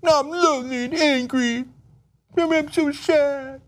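high whiny crybaby voice, slowing at the end; whiny child. Retold or imitated. Host mocking the president as a sulking child, high and whiny. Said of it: "they're like".

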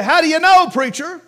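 lightly voiced doubter calling out high and loud; mock heckler. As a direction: challenge the preacher like a sceptic.

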